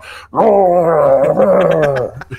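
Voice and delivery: long, loud, high roar into the mic; huge drawn-out voice-acting bellow; yelled character roar. Shown as a demonstration. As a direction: demonstrate an all-out yelling roar. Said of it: "sound like me going".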